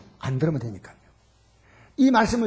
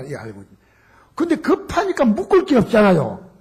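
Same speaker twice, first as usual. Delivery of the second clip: ordinary talk, nothing marked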